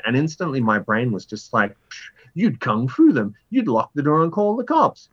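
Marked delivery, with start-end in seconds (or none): 1.9-5.1 higher, cocky inner voice; brain's brash voice. Retold or imitated. His brain pitching options at him, high and cocky. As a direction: toss off each wild option like it's obvious.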